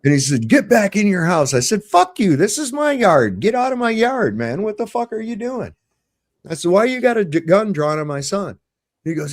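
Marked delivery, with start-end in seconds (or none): 0.5-1.5 high, louder police command; retold shouted order. Cop ordering the father indoors, retold shouted order. 1.8-5.7 high-pitched indignant yelling; retold angry outburst. Father ordering cop off his yard, retold angry outburst. 6.7-8.6 high, loud-starting protest; retold indignant demand. Father questioning cop about drawn gun, retold indignant demand. Retold confrontation over son.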